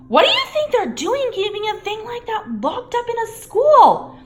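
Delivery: high, loud incredulous; stunned Ron. A narrator's reading. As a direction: still shocked, demanding. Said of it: "said Ron finally".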